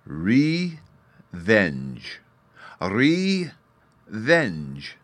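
"Revenge" said slowly and clearly, twice; demo pronunciation. Shown as a demonstration. Narrator cheerfully modelling "Revenge" for the fairy boy. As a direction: model "Revenge" for a child to copy. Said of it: "try and pronounce it".

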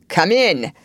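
put-on gruff old man calling out. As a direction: bark it out like a crusty old gentleman. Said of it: "a gruff voice called out".

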